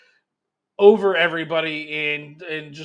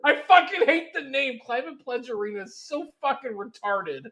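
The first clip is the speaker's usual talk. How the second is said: laughing; 5 semitones higher, 6 dB louder than usual. Real, not acted. Mocking the arena name.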